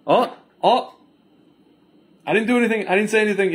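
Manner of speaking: high, whining innocent protest, pitched well up; mock whiny denial. Vlogger mocking the official's whining denial at a tribunal. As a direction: play the wronged innocent, hands up. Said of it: "he's basically now".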